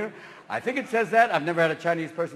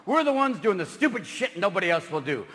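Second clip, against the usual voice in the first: normal talking voice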